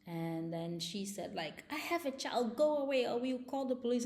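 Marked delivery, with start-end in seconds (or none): 1.7-4.0 higher, louder, fast mock shriek of the wife; shrill-free raised wife voice, hurried and urgent; retold scream. Wife shouting through the door at the neighbor, imitated loud and fast. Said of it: "she started screaming".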